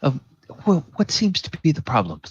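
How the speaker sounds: kindly priest. Acted. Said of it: "Father Baldwin says".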